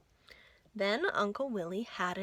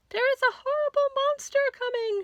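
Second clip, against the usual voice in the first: very high, loud, fast panicked cry; terrified cry; 6 dB louder, faster than usual. Acted. Auntie Pastry on the dock crying out in terror, very high. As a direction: shriek a warning in terror. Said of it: "she cried".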